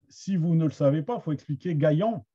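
ordinary delivery

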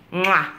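kissing sound. Real, not acted. Kisses baby.

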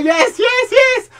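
very high-pitched yeses; thrilled, rising high outburst; excited cheering. Real, not acted. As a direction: cry out each yes high and excited.

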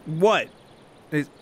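ordinary delivery

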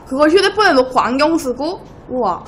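ordinary talk, nothing marked

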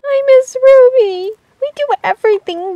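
sad whine. Acted. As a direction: draw it out like a homesick kid. Pony misses her friend.

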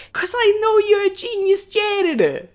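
loud, high hammed-up Boston movie voice; overdone Boston yelling; bad Boston accent. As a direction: play a Good Will Hunting scene in a bad accent. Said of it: "I'm gonna do a scene from it".

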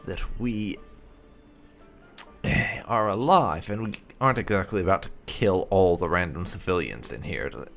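ordinary delivery

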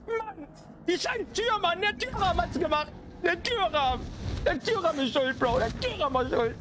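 high-pitched exasperated complaining; frustrated outburst. Real, not acted. Gamer blaming the door frame, frustrated high-pitched rant. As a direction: protest in a high, wailing voice, hammering the blame home.

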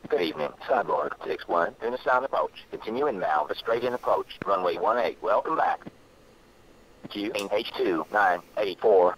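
filtered tower reply, calm and routine; over a radio. Real, not acted. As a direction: air traffic controller on radio.

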